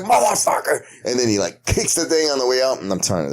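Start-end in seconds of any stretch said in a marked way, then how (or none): 0.0-0.8 much higher shrieked curse; hammed-up falsetto swear; imitating the curse. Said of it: "He was like".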